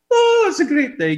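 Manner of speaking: high, loud, giddy; the foolish man giggling; giggling prisoner. Pretend. Death-row man giggling to himself, high and giddy. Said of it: "giggling to himself".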